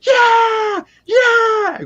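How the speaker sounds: high, loud gleeful yelling; excited cheer. Retold or imitated. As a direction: jump up cheering like your wish came true. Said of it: "literally jumping up and going".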